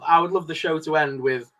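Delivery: normal talking voice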